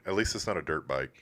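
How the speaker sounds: normal talking voice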